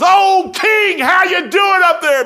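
high, loud, slow, easygoing call upward; cheerful shout up. Acted. Daniel answering the worried king from the den, loud and carefree. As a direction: shout up breezily from the pit. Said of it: "Daniel goes".

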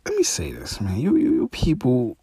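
hushed, breathy lead-in before the rant; whisper. Real, not acted. Host to his viewers, hushed whisper. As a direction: drop to a whisper to grab attention. Turning to confront the critics.

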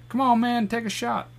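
a high coaxing call; high bartender voice. Retold or imitated. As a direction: pitch it way up, pushy. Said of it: "the bartender was like".

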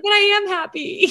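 teary insistence. Retold or imitated. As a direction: insist through tears. Retold teary addition.

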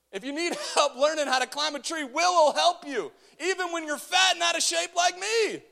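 high, loud shouted exhortation; full-force preaching. Real, not acted. Preacher telling the church to climb a tree, high shout. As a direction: holler the story out at full voice.

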